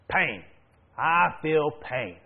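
slow, emphatic complaint of a grandma; mild mock grumpiness. Grandma answering the man who prayed, mildly grumpy imitation. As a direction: draw out the complaint, lightly acted.

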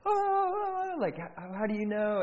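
high mock wail, imitating a sobbing woman; bawling. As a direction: wail it like someone overcome. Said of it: "this lady starts bawling, crying, like".

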